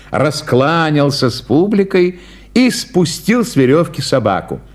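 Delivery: usual speaking voice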